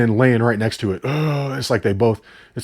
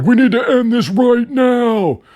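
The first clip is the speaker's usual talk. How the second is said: high, loud, slow hammed-up hero line; mock action hero; 11 semitones higher, 5 dB louder than usual. Reviewer mocking the hero calling out the bear, high, loud and slow. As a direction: ham up the tough final challenge. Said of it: "He's like".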